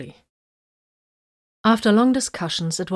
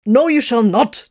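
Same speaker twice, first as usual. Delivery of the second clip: loud, slow, testy cut-off; testy interruption; 5 semitones higher, 7 dB louder than usual. Acted. Tiberius cuts off the machine spirit, testy and loud. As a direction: shut him down sharply. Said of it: "interrupted him testily".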